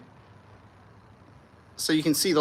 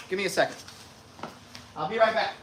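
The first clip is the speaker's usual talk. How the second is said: ordinary talk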